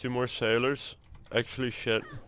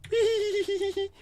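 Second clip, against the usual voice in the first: high laugh. Real, not acted. Laughs after accent joke.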